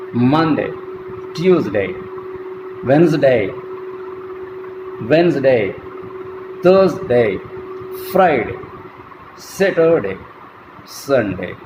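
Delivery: Monday to Sunday with each first syllable stressed and day unstressed; teacher demo with first-syllable stress and a weak day. Shown as a demonstration. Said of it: "the stress falls on the first syllable".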